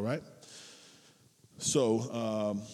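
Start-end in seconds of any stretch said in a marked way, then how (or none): none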